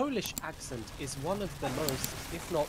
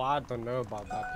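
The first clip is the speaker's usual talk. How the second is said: ordinary talk, nothing marked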